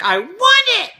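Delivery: high, loud mimicked child demand; loud kid tantrum. Kid in the cart to his mom, loud tantrum. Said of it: "he was much louder than I was".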